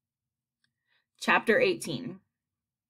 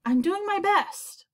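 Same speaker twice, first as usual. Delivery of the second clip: high, slow plea; poor glowworm; 12 semitones higher than usual. A narrator's reading. Poor glowworm to impatient creatures, high slow plea. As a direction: plead high and drawn out. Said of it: "answered the poor glowworm".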